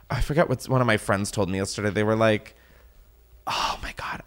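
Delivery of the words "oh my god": hushed, whispered aside; unvoiced-sounding murmur under the breath. Real, not acted. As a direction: whisper it to yourself. Searching memory for friend's remark.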